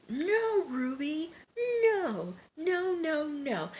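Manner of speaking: very high, drawn-out mock dismay; dismayed pleading. Viewer begging Ruby after the shot, high dismay. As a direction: plead in a high, thin wail. Reacts aghast to the shooting.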